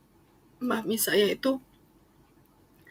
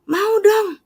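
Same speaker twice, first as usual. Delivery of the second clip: higher, louder imitated mother, keen; eager mother; 11 semitones higher, 5 dB louder than usual. Mother eagerly agrees to baptism, high and keen. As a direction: say it like a mom eager to be dipped.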